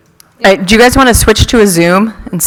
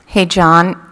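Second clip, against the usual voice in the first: ordinary talk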